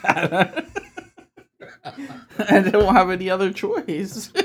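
laughing while conceding. Real, not acted. Friends cracking up on podcast.

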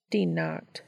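ordinary delivery, nothing marked

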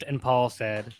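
usual speaking voice

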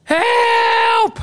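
very high, long-held cry; stretched, high-pitched mock plea; mock scream for help. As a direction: wail it out, hammed up. Acting own scream for help.

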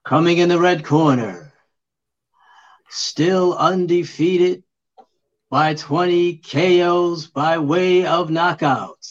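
drawn-out ring announcer intro; slow, building fight-night announcement; boxer announcer voice. Pretend. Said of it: "boxer announcer voice".

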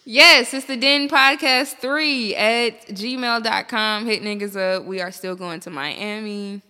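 normal talking voice

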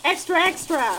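loud newsboy shouting headlines; newsboy call. Pretend. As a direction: call it out like a 1940s paperboy. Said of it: "The same guy that said extra, extra".

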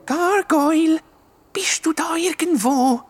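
Gargoyle whispered, high, bist du da irgendwo hushed; muffled call. A narrator's reading. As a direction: call out under your breath. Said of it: "mit einer gedämpften Stimme".

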